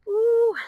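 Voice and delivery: a high, loud yelp of effort; high strained ooh. Real, not acted. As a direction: let a sharp high ooh out under the strain. Instructor straining mid one-arm push-up.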